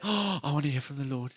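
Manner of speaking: a light mock-eager churchgoer voice. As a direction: parody the Sunday-only believer. Mocking Sunday churchgoer.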